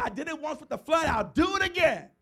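loud, high threat of the flood returning; threatening God. Acted. Preacher as God threatening another flood, loud and high. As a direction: warn like a god about to strike.

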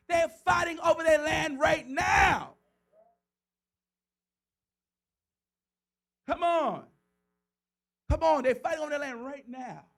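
loud, high, slowed proclamation; preaching shout. Real, not acted. Preacher shouting to the church about land fought over today. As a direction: shout it to the congregation.

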